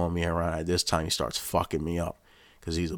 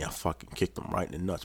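ordinary delivery

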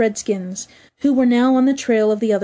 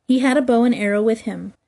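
ordinary delivery

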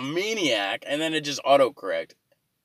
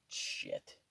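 whispered curse; 19 dB quieter than usual. Real, not acted. Muttered curse.

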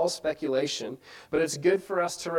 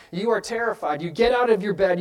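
normal talking voice

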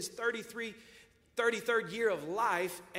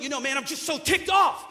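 loud, hammed-up grumbling God; a cartoon God fuming in heaven; preacher's mocking God voice, loud; mock ticked-off God; 8 semitones higher, 12 dB louder than usual. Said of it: "What do you think God was up in heaven doing".

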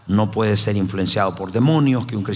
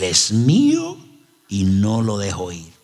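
slow, lowering possessed growl; slow menacing demonic voice; 3 semitones lower, slower than usual. Retold or imitated. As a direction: speak as the demon claiming the man. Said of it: "me dice con una voz así".

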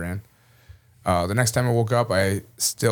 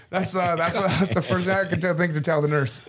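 laughing through the words, voice pitched up; 10 semitones higher than usual. Real, not acted. Telling the crowd his nurse joke, laughing. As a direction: chuckle as you admit it.

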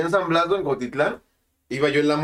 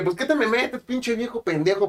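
retold insult; 6 semitones higher, slower than usual. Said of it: "Y le dije".

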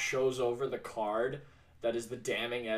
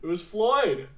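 excited; 8 semitones higher, 6 dB louder than usual. Real, not acted. Confirming triumphantly.

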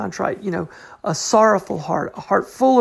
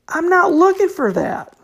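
emphatic rejection; 10 semitones higher, 4 dB louder than usual. Retold or imitated. God's voice quoted emphatically.

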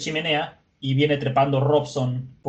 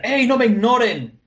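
high, loud acted yell; imitated indignant outburst; indignant shout; 10 semitones higher, 5 dB louder than usual. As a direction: shout out demanding attention. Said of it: "Chopper les grita".

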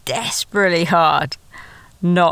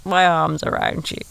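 mock casual move; 3 semitones higher than usual. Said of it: "a yawn and stretch".

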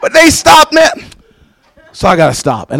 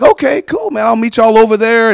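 upbeat agreement; 6 semitones higher than usual. Retold or imitated. Pastor replays agreeing to meet friends.

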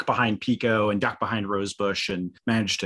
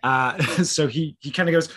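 ordinary talk, nothing marked

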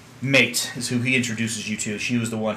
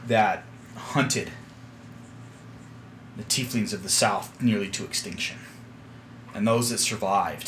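grave storytelling; 2 semitones lower, 5 dB quieter than usual. Acted. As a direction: recount the dark history solemnly. Captain recounts grim history.

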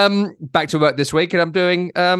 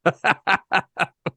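laughing; 3 semitones higher than usual. Real, not acted. Laughing at his own reading.

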